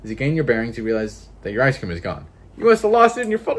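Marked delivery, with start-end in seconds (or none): 2.6-3.6 high, loud dramatic build-up; mounting mock despair. Narrator announcing the lost ice cream, in mock despair. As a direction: pitch it up toward a wail.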